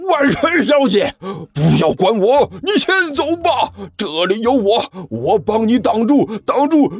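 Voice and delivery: high, straining heroic yell; hoarse shout. A narrator's reading. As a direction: shout at the top of your lungs. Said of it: "声嘶力竭地对着万晨开始说道".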